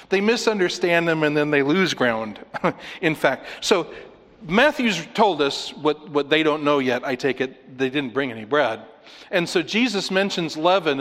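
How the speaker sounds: ordinary talk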